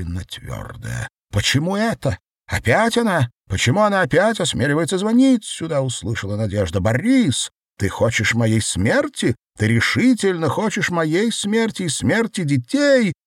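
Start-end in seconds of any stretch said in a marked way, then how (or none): 1.3-5.8 high, loud, fast indignation; seething woman. A narrator's reading. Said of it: "клокочущий женский голос". 6.8-13.1 high, raised, indignant accusation; seething woman. A narrator's reading. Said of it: "клокочущий женский голос".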